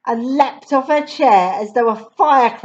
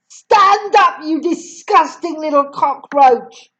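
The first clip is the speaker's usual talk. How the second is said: higher, louder snarl; angry order; 5 semitones higher, 4 dB louder than usual. Acted. Headmistress ordering Matilda up, higher and louder. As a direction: spit the insult down.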